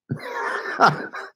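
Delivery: real burst of airy laughter; amused laugh. Real, not acted. Streamer reading a viewer's snowflake comment, amused laugh. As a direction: laugh at something absurd you just read.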